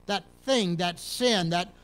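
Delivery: ordinary talk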